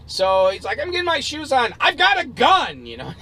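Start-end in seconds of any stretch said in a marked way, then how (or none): 0.8-2.9 high-pitched imitation of an angry yell; yelling threat. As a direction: holler it as a warning from afar. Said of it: "He's yelling at me".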